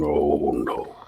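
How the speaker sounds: low, soft, drawn-out refusal; hushed, low mock dismay; disapproving groan. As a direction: imitate a head-shaking naysayer. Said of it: "he's shaking his head going".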